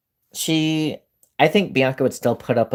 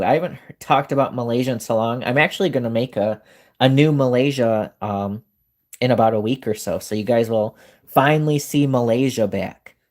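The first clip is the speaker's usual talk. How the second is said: ordinary talk, nothing marked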